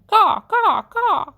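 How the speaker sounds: high, loud mocking caws; crow caw. A narrator's reading. Edward imagining himself a crow laughing at the man, high caws. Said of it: "laughed at the man".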